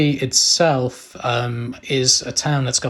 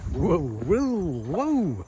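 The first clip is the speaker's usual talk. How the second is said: startled whoa; 5 semitones higher, 6 dB quieter, much slower than usual. Real, not acted. Bridge wobbles under him.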